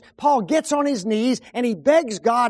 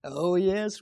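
normal talking voice

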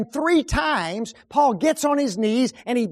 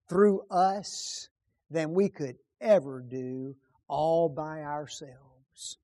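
usual speaking voice

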